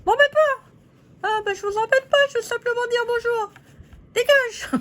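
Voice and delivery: high squeaky paper-doll voice, bickering; squeaky puppet lady. Acted. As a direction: play a cheeky little figurine squabbling with her neighbour. Puppet ladies squabble again.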